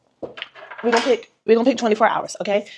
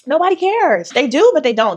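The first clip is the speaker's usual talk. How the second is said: higher and louder, pressing the point; emphatic exclamation; 6 semitones higher, 5 dB louder than usual. Real, not acted. Author insists to fellow writers that nobody cares, loud and high. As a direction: throw it out loud to drive it home.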